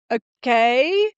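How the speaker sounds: drawn-out okay sliding up high into a question; baffled acceptance. Retold or imitated. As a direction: act out a hesitant, taken-aback okay. Stunned by surprise presidency offer.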